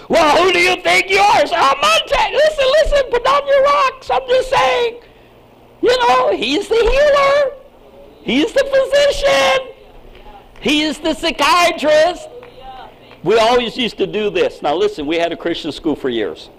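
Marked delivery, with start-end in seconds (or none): 0.1-1.5 high, louder, laughing indignation; mock-offended Facebook critic; indignant attacker. 1.5-12.3 fiery preacher building to a peak; full-force preaching. Real, not acted.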